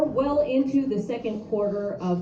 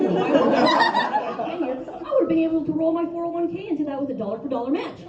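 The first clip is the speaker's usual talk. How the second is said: giggling through a rushed punchline; laughing through words; 6 semitones higher than usual. Real, not acted. Comic cracking up mid joke, giggly and rushed. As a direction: laugh while you race through it.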